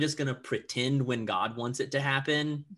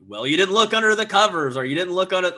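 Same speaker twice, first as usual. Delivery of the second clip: higher, much louder mock girl voice; girl scolding a searcher; 7 semitones higher, 11 dB louder, faster than usual. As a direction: scold loudly, pitched up. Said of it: "Girl is like".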